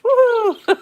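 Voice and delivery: high, loud mock cheer; mimicked woo-hoo cheer. User copying the voice assistant's cheer, high mimicked woo-hoo. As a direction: whoop it up in imitation, very high.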